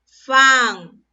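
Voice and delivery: "Fan" loud and isolated; stressed syllable. Shown as a demonstration. Teacher answers which has most voice force, "Fan" said forcefully. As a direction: punch "Fan" as the strongest syllable. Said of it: "¿Cuál tiene la mayor fuerza de voz?".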